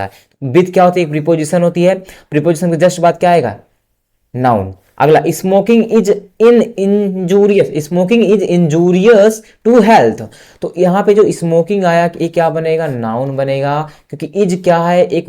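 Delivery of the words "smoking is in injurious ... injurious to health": very slow, higher and louder; slow dictation to a class. Shown as a demonstration. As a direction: read it for students to copy. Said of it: "dictating an example sentence slowly".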